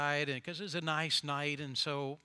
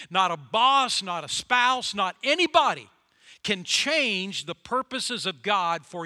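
preaching at full force; 7 dB louder than usual. Acted. Preacher to the congregation, loud and rising. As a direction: hammer it home to the church. Sermon climax.

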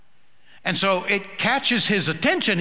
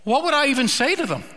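much higher, fast, desperate question; exasperated Moses; 9 semitones higher, much faster than usual. Retold or imitated. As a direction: throw up your hands as a flustered Moses. Moses pleading with God.

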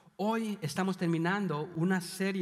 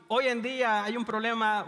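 normal talking voice

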